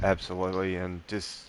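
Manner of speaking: fighting back tears; teary. Real, not acted. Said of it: "I did get a bit teary".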